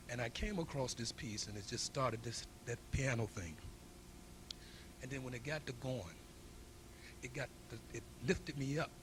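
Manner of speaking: ordinary talk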